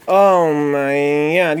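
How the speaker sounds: very slow, stretched-out amazement; drawn-out awe. Pretend. Streamer beholding his pizza, drawn-out awe. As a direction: stretch every word in awe. Savouring first look at pizza.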